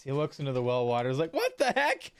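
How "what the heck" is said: high-pitched yelp of disbelief; high, astonished teenage cry; startled older kid. Acted. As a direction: blurt it out, shocked by your reflection. Older kid startled by his changed face.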